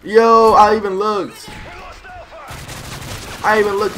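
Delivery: loud, high, indignant protest; angry outburst. Real, not acted. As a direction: yell it in disbelief. Angry outburst at a teammate's kill.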